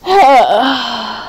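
long, heavy exhale; exasperated sigh. Real, not acted. Streamer fed up with the chat, long exasperated sigh.

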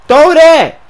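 very high, very loud yell; desperate scream. Real, not acted. Ignored receiver begging for the pass, desperate scream. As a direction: scream for the ball at full force. Demanding the pass, more forcefully.

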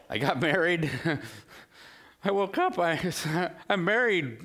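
high, laughing Jacob in disbelief; laughing mock panic. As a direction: play Jacob waking up to the wrong bride, laughing. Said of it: "bad honeymoon story".

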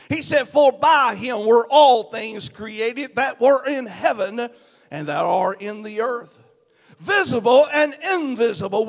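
loud, high, ringing pulpit delivery with hammered words; full-force preaching. Acted. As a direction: preach it to the back row, building and pounding. Preaching the sermon body.

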